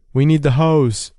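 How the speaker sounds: shouted call for the hose; yelled. A narrator's reading. Fire crew yelling for the hose over the flames. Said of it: "the fire crew yelled".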